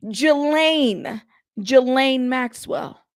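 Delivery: Ghislaine as "juh-LAIN", S left silent; hard-G pronunciation. Shown as a demonstration. Host correcting viewers on Ghislaine, juh-LAIN, emphatic. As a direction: say Ghislaine firmly, weight on LAIN. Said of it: "It's a hard G".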